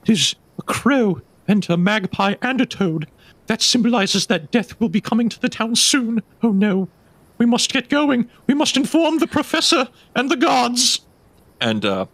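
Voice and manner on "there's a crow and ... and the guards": much higher, dramatic voice spelling out a doom omen; high, panicked Stephanie; alarmed townswoman. Acted. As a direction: play a jittery villager seeing death coming. GM voices Stephanie reading omens.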